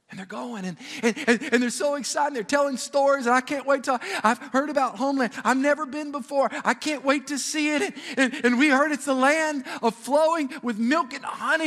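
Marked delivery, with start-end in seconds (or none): 1.5-11.7 higher-pitched, breathless-with-anticipation chatter; acted eager excitement. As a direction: gush like travelers bound for home. Acts out Hebrews eager for homeland.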